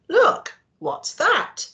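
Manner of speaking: a hint of startled curiosity; surprised spotting. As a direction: point and wonder aloud. Spotting something on water.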